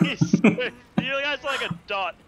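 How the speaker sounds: laughing high and loud; bursting with laughter mid-sentence. Real, not acted. Laughing at teammate.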